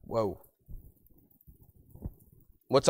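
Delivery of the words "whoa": soft, falling stunned whoa; mock astonished whoa. Preacher reacting to Martha rebuking the Lord, softly stunned. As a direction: react as if taken aback. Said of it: "My only response to that is".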